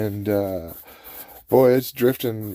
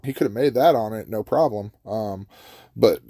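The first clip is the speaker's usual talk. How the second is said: ordinary delivery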